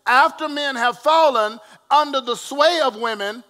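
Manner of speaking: loud, rising preacher cadence; preaching. Acted. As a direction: preach it to the congregation. Preaching at full force.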